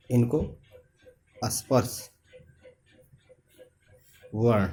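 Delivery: इनको, स्पर्श, वर्ण each set apart by long pauses; word-by-word dictation. Shown as a demonstration. Teacher repeats term piece by piece. (इनको, स्पर्श, वर्ण रुक-रुक कर, लिखवाने की तरह)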